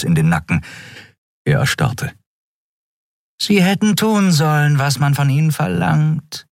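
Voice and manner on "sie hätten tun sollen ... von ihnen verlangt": high purring villainess. Acted. Shiar purrs behind the admiral's back, menacing. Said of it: "schnurrte Shiar".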